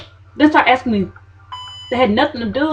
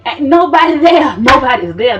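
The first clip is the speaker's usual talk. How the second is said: exasperated; 6 semitones higher, 7 dB louder than usual. Real, not acted. Exasperated complaint in waiting room.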